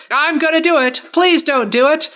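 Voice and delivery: high, escalating comic argument; hammed-up back and forth. Bugs tricks Soren with reverse psychology, escalating hammed-up back and forth. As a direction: play both sides, rising each time.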